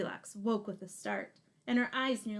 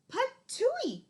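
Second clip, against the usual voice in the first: very high, loud spit-out patooey; spitting patooey; 6 dB louder than usual. Acted. Goldilocks spits out the too-hot porridge, loud high patooey. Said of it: "cried Goldilocks".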